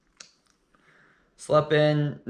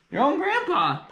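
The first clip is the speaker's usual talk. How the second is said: much higher, excited cry; excited exclaim; 13 semitones higher than usual. Acted. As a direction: throw it up high in delight. Exclaims the punchline.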